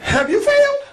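high called question. Shown as a demonstration.

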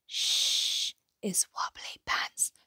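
hushed, voiceless password; shush, then whisper it like a secret; secretive whisper. A narrator's reading.

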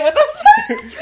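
high, loud, drawn-out mock outrage; shocked outburst. Imagining being woken by vomit, shocked outburst. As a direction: squawk it through laughter. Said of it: "I'm like".

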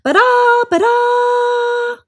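very high, loud sung trumpet call; elephant trumpet. Acted. Elephant trumpeting in the mud, high and loud. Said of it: "trumpeted loudly".

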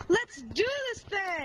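slow, drawn-out hype call; hyped gamer bro. Acted. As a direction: pump up the viewers. Hyping the speedrun start.

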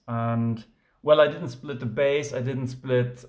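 ordinary talk

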